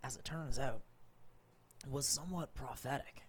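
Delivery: recap narration. Acted. Game master's recap of last session.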